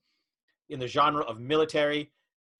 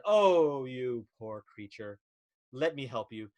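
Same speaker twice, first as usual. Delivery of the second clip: tender pity; 4 semitones lower, slower than usual. Acted. Heroine takes pity.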